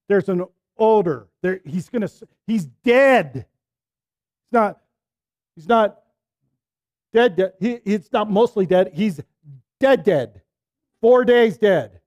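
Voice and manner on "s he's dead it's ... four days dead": ordinary delivery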